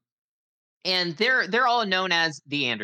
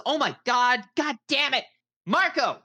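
yelling at the twins; 10 semitones higher than usual. Acted. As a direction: shout for the boys, fed up. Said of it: "she yells at".

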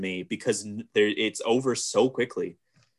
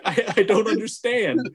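laughing confusion; 9 semitones higher than usual. Retold or imitated. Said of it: "It was like".

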